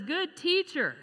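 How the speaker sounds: high-pitched, hammed-up flattering greeting; puffed-up pride. Rich young ruler buttering Jesus up, mock puffed-up pride. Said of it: "all proud and puffed up and says".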